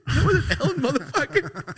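very high mock scolding; mimicking Rosie scolding. Rosie correcting her friend, high mock scold. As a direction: squeak out the correction like an exasperated friend. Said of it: "And she was like".

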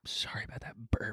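soft embarrassed whisper. Real, not acted. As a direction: whisper the apology under your breath. Apologizing quietly after a burp.

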